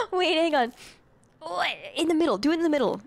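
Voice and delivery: ordinary talk, nothing marked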